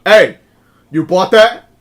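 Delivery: loud challenging call; cop calling out. Retold or imitated. Cop confronting the shopper, calling out loudly. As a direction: bark it at him like a suspicious cop. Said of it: "looks at him and goes".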